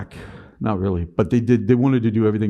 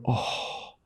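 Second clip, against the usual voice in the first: exhausted sigh; 3 semitones lower, 4 dB quieter, slower than usual. Retold or imitated. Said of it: "I'd go into my office and I'd go".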